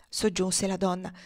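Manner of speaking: usual speaking voice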